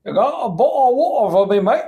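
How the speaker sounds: a rough London Cockney asking for water; Cockney accent. Acted. Bamboozling people expecting the fancy one, rough Cockney accent. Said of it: "then you come out like".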